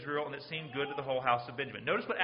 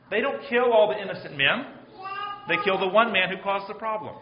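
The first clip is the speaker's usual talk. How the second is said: loud, raised, driving the point home; emphatic preaching; 4 semitones higher, 7 dB louder than usual. Real, not acted. Preacher pressing the congregation, loud and raised. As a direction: preach it out hard.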